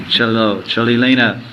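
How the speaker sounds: ordinary delivery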